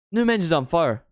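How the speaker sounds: loud, high commentator shout; excited praise. Real, not acted. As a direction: hype the player up at full volume. Live praise of player.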